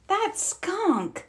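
high, soft, squeezed-up cub voice of disgust; disgusted complaint. A narrator's reading. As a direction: whine it like a young cub smelling a skunk. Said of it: "complained Brother, making a face".

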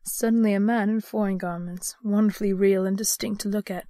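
ordinary delivery

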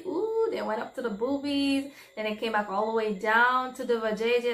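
ordinary talk

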